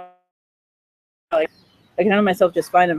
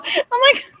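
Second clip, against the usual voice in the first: ordinary talk, nothing marked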